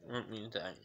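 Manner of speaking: talking while chewing gum. Real, not acted. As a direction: mouth full of gum. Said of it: "Sorry, I'm chewing gum right now".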